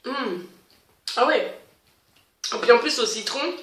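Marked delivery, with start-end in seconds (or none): 0.0-1.6 talking while chewing candy; words around a mouthful of sour candy; eating sour candy. Real, not acted. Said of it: "Ah, ça t'attaque direct".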